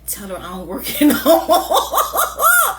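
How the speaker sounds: coworker's line, high and loud through laughter; laughing quote. Retold or imitated. Retelling the coworker hiding from her, laughing. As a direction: quote him while cracking up. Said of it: "he in the back talking about".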